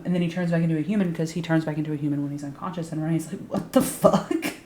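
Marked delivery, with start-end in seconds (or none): 3.5-4.5 drawn-out, incredulous mock outburst; mock bewilderment. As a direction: act out stunned disbelief, stretching the words. Retold character's bewildered reaction.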